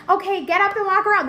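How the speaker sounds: high, loud bossy cheer; mocking a nurse's bright orders, loud and high; chirpy nurse. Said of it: "That's what the nurse was telling me".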